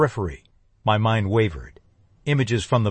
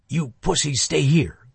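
growled; 4 semitones higher than usual. A narrator's reading. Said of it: "growled Johnny".

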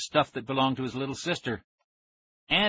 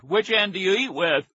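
raised, higher jokey shout; booming; 7 semitones higher, 3 dB louder than usual. A narrator's reading. Dad mocking son who sat on his sandwich, booming. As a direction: boom out the teasing question. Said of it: "he boomed".

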